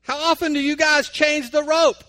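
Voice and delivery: a scared tourist calling up; trembling voice. Retold or imitated. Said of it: "So in a trembling voice".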